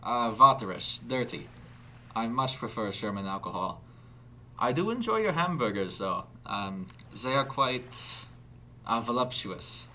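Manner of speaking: put-on halting German accent, groping for words. A narrator's reading. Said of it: "a German accent now readily apparent".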